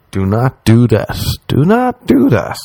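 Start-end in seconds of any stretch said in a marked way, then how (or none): 1.5-2.6 much higher and slower, begging; high pleading repeat. Retold or imitated. Pleading with suicidal girl.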